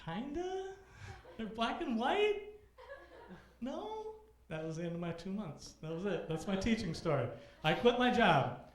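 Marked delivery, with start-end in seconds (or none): 0.1-4.1 much higher, hopeful half-laughing guesses; sheepish. Retold or imitated. Answering the principal's question, sheepish and laughing. 7.6-8.6 loud and higher punchline; emphatic. Real, not acted. Announcing the quitting to the crowd, emphatic and loud.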